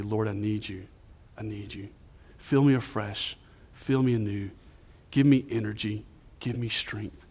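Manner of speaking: soft, low plea to the Lord; private prayer. Retold or imitated. Preacher retelling his prayer in the study, hushed pleading. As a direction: pray it quietly in short, spaced phrases. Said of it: "and I just say".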